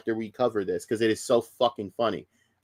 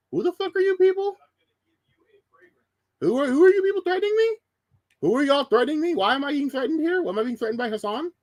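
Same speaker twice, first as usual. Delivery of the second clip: much higher, bewildered voice of the wrong guy; confused innocent guy. Retold or imitated. Streamer voices the doxxed innocent man, baffled and high. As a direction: play a baffled stranger getting threats. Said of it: "sitting there going".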